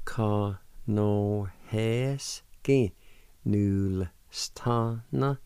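"Conohesky Nulstana" stretched syllable by syllable; slow demonstration. Shown as a demonstration. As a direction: draw out each part very slowly. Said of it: "and together".

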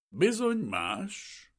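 slow, high sighed agreement; acted sigh, high and drawn out. A narrator's reading. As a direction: draw it out like a sigh. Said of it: "sóhajtott Vrana Basil".

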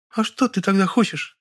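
high female voice, slightly worried; high-pitched anxious question; uneasy. A narrator's reading. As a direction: ask with a touch of worry, high. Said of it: "забеспокоилась Лёля".

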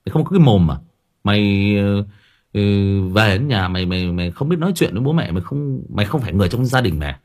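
loud, exasperated telling-off of a son; scolding parent. Retold or imitated. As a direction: scold the teenage boy hard. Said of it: "mình phải quát". (cha mẹ quát mắng đứa con trai, to tiếng)

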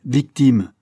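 loud, scornful exclamation; indignant echo. A narrator's reading. Father scorning his son's excuse, indignant. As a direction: fling the word back at him. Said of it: "fit monsieur Berthomy".

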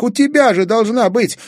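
put-on high, loud boyish cry of realization; sudden shout. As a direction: shout out the idea. Kazik urgent. (внезапный крик)

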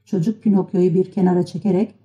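usual speaking voice

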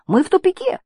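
very high, loud cry; high-pitched shout of dismay. A narrator's reading. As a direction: yell it in alarm. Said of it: "крикнул он".

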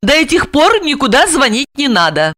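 high, insistent female voice; determined, pitched high; decisive. A narrator's reading. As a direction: lay down the condition firmly. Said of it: "решительно отрезала она".

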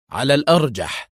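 usual speaking voice

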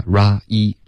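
"ra一" as rah gliding into ee; stretched "ra一", ah to ee; sliding diphthong. Shown as a demonstration. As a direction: spell out the glide in "ra一". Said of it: "双元音一定要滑动".

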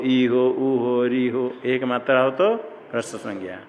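usual speaking voice

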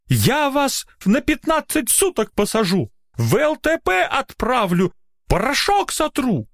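very high-pitched threats at moderate volume; angry yelling. A narrator's reading. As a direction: fire off furious threats in a high register. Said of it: "перешёл он на крик".

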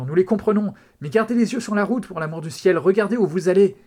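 loud, high-pitched pleading shout. A narrator's reading. Husband begging the cop to watch the road, shouting. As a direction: yell it urgently at the driver. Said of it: "cria Peter".

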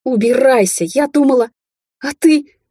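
high, loud furious yell. A narrator's reading. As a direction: scream it at him in rage. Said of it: "заорала".

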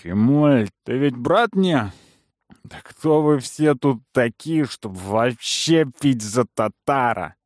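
put-on drunk belligerent. Drunk man picks a fight.

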